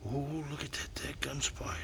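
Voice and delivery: hushed whisper. Real, not acted. Man watching a big spider whispers in surprise. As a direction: whisper so the critters aren't disturbed.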